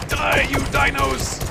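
high, drawn-out war cry; mock battle cry. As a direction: wail it high at the enemy.

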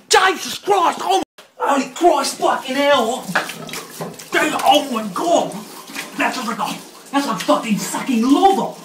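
high loud yelling, mouth on fire; mouth burning from spicy food. Real, not acted. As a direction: shout through burning chili heat. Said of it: "My mouth is on fire".

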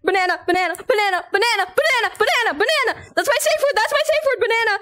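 panicked high yelling, laughing at the end; 1 frantic yelled safe word. Real, not acted. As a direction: scream the word to make it stop. Shouting safe word while chased.

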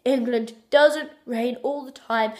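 ordinary talk

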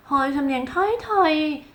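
high-pitched, drawn-out mock whine about a Thai accent; mock self-conscious complaint. Learner worried about having a Thai accent, mock complaint. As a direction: voice a learner's slow, high fretting. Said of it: "สำเนียงเราเป็นแบบ".

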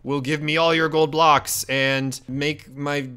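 slow, drawn-out pompous impression; Grazer. Demanding gold blocks as Grazer, hammed-up slow impression. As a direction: proclaim it very slowly, badly faking someone else. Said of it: "I'll be Grazer".

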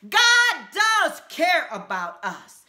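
ordinary talk, nothing marked

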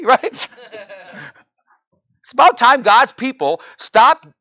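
high, loud, building outrage; impassioned preacher climbing in pitch; fiery preaching. Acted. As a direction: preach it at full force, incredulous. Preaching at full force.

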